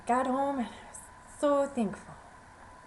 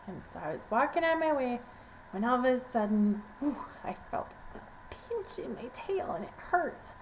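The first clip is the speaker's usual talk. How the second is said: plain reading voice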